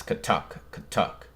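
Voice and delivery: soft whispered footsteps; hushed katuk katuk footstep noise; mouthed footstep sounds. Retold or imitated. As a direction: click out footsteps under the breath. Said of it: "footstep sounds".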